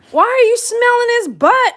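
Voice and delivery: high, loud incredulous cry; loud exasperation. Real, not acted. Owner to dog sniffing the other's rear, exasperated. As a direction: belt the question out in disbelief. Exclaiming at the dog's behavior.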